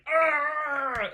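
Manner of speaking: sudden high loud cry of pain; pained scream. Retold or imitated. Teacher acting out a dying man's pained scream. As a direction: let out a strangled scream of agony. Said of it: "his last words were just like".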